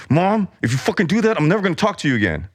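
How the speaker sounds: put-on high, fast bratty kid voice; a kid threatening mom, high and rushed; childish outburst. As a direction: blurt it like a furious little kid. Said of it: "the child in me".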